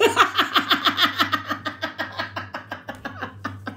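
long breathy giggle; airy laughter. Real, not acted. After quoting the curse, breathy laughter. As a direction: dissolve into soft giggles.

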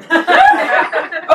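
real high laugh falling away; a genuine laugh at the absurd line. Real, not acted. Narrator laughs at own story.

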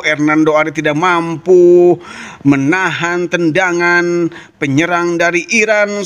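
usual speaking voice

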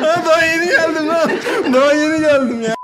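high, loud laughing protest; laughing shout. Real, not acted. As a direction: yell it while cracking up. Laughing protest after punchline.

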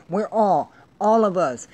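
normal talking voice

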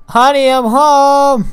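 loud, high, drawn-out holler; mock homecoming call. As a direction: call it out through the house, stretching it. Calls out on arriving home.

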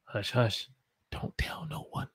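hushed, then a whisper; secretive hush. Pretend. Teacher hushing viewers about a secret. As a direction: keep it secret, drop to a whisper.

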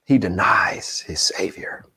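breathed out, almost voiceless; whispered. A narrator's reading. Preacher reaching Peter's denial, whispered. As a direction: let it fall to a whisper.

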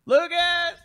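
loud, high shout of a name; calling out. Real, not acted. Host summoning the crew member from off, calling out. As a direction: holler for someone across the room.

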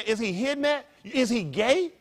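ordinary talk, nothing marked

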